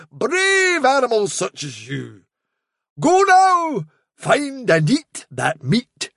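lofty, swooping proclamation; grand fairy messenger, solemn. Acted. Impostor sends hungry hound away to find meat, grandly. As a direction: pronounce the test like a sacred quest.

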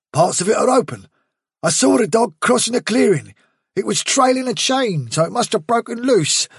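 a tough rabbit urging the leader to move now; breathless soldier rabbit; Bigwig's urgent voice. A narrator's reading.

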